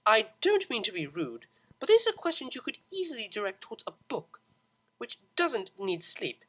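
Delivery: loud, tight exasperated reply; losing patience with her; irritated. A narrator's reading. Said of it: "pushing forward irritably".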